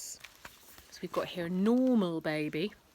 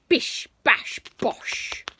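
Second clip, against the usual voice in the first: sound effect words; 4 semitones higher, 9 dB louder, much slower than usual. A narrator's reading.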